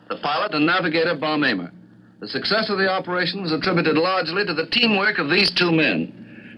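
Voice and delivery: formal wartime radio announcer; measured, dignified news introduction; grave 1940s war broadcast. Acted.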